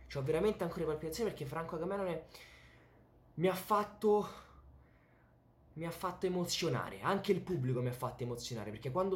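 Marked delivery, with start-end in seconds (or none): 0.1-4.3 fast, high, breathless excitement; excited rush. Real, not acted. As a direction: still buzzing from the match. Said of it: "io c'ho ancora le palpitazioni". (eccitazione travolgente)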